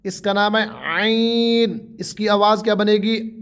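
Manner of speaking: usual speaking voice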